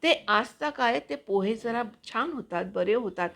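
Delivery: voice-over reading. Acted. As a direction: read it like an expressive voice-over. Reads her own voice-over piece. (उत्साही voice over वाचन)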